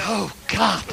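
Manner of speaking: usual speaking voice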